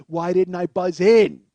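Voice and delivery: loud, slow cry of regret; anguished self-reproach. Retold or imitated. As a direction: wail it at yourself, stretching the words. Regret at the lost money.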